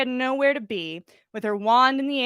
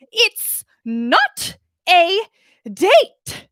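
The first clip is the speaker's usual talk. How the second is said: slow, much louder, higher yell; 8 semitones higher, 9 dB louder, much slower than usual. Acted. Umbridge yelling at the server, outraged. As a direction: shriek it out in outrage. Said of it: "she yelled".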